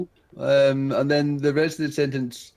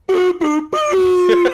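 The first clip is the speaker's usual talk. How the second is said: very high falsetto boos, louder; [name] falsetto; 13 semitones higher, 7 dB louder, much slower than usual. Pretend. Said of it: "I thought that was a [name] impression".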